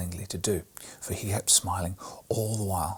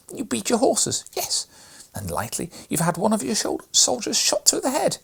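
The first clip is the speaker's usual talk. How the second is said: put-on high, louder upset girl's pleading; tearful girl; 14 semitones higher, 5 dB louder than usual. A narrator's reading. Maria scolding her brother, high and tearful. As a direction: scold him through the tears.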